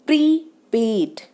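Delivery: slow prepaid, equal force on pre and पेड; both syllables stressed. Shown as a demonstration. Teacher showing stress on both, slow PRE-PAID for prepaid. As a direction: separate prepaid and press both halves. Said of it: "दोनों पे stress आ गया".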